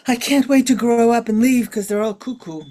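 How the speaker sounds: a playful hint of exasperated youth; exasperated kid. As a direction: blurt it like a kid desperate to get out. Childhood wish to escape crazy family.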